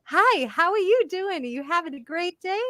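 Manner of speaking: sugary polite stranger. Retold or imitated. Outsiders greeting her, high saccharine cheer. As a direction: chirp it out sweetly. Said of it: "they're like".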